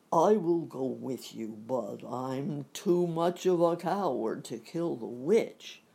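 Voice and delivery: a cowardly lion offering bravely; hammed-up lion voice, loud; lion's voice. The lion speaks, quoted in the story.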